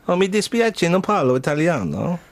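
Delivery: put-on Italian lilt; Italian accent. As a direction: play an Italian apologising. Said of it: "him trying to do an Italian".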